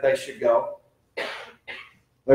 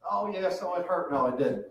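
usual speaking voice

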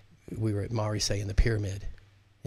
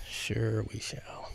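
slow whispered self-answer; 5 dB quieter, much slower than usual. Real, not acted. Host answering his own question to listeners, slow whisper. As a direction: breathe it out, slow, just to yourself.

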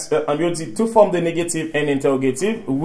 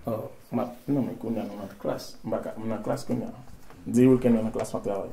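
aparté à voix basse; 6 semitones lower, 12 dB quieter than usual. Real, not acted. Aside during class, phone.

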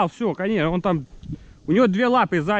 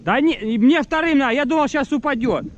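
high and loud, worked up; fired up about the shot; excited; 10 semitones higher, 4 dB louder than usual. Real, not acted. Excited about missed bird. (возбуждённо)

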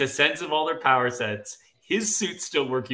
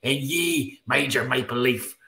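put-on Irish brogue at your usual pitch; 3 dB louder than usual. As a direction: declaim it in a theatrical Irish brogue. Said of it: "in your best Irish brogue".

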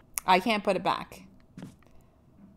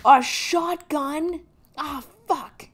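excited gasp; 9 semitones higher, much slower than usual. Real, not acted. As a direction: gasp and gush over the find. Finds a shotgun.

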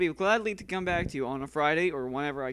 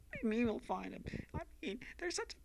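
high, soft bitter rant; angry girl voice; 11 semitones higher, 8 dB quieter, faster than usual. Acted. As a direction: snap like a fed-up girl. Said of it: "let me try this".

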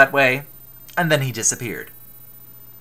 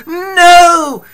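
long, high, loud wail of refusal; exclaimed no; 13 semitones higher, 11 dB louder than usual. Acted. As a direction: cry out in horror, drawing it out. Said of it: "exclaimed Apollo".

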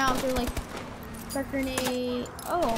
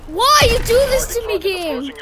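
high, loud protesting plea; dismayed wail; 8 semitones higher, 7 dB louder than usual. Real, not acted. Player pleading with the game about dogs, dismayed wail. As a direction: cry out in dramatic dismay.

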